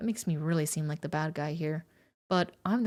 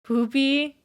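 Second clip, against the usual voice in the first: spooked call; 7 semitones higher, 7 dB louder than usual. Real, not acted. Calling out, spooked.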